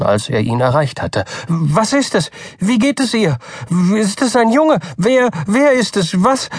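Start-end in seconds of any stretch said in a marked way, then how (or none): 1.5-6.5 high, tumbling anxious questions; babbling nervous father. A narrator's reading. Nervous father fires questions at the doctor, high and frantic. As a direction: stammer out questions in a panic. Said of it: "Reden Sie vernünftig".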